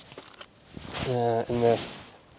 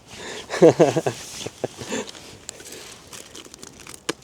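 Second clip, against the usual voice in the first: laugh; 3 semitones higher than usual. Real, not acted. Laughs after leg burning.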